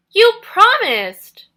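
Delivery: high, very loud, slow scream; furious scream. Acted. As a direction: scream the betrayal at him. Nico screams accusation.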